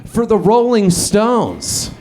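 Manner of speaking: high, hyped-up radio DJ patter; imitating a pumped-up FM host. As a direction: put on an excited DJ voice. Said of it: "the D J goes".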